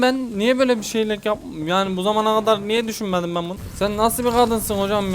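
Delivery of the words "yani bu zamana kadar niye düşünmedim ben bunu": normal talking voice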